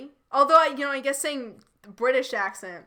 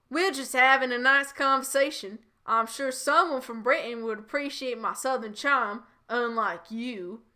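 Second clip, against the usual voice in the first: Southern accent. Shown as a demonstration. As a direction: play George as a Southern charmer. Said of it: "I will do Southern".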